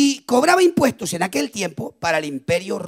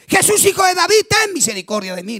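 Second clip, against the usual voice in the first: grito de súplica; 9 semitones higher, 4 dB louder than usual. Retold or imitated. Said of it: "Le gritaba bien fuerte".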